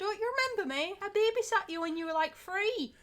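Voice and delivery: high-pitched imitation of mum's friend; high, eager mock voice; gushing family friend. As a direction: play the babysitter surprised to be forgotten. Imitates mum's friend expecting recognition.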